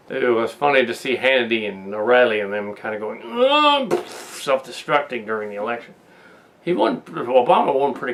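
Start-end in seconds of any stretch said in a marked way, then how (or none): 3.2-3.9 high, drawn-out imitated groan; exaggerated high-pitched "ugh" in imitation; mock strangled groan. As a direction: groan like a pundit falling apart. Mimics pundits self-destructing on election night.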